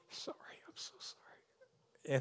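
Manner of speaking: slow, tearful whisper; barely voiced, heartfelt sorry; whispered apology. Real, not acted. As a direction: whisper it with deep compassion. Tearful apology to hurting woman.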